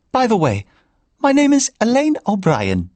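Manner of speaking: put-on high Irish old woman's voice, pitched well up; a chatty Irish grandmother complaining lightly; Irish lilt of an older woman; Irish accent. A narrator's reading. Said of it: "in an Irish accent".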